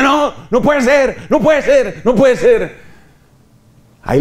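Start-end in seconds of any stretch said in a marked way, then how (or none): 0.0-2.8 outcry. Retold or imitated. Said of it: "A uno le estalla la gritería".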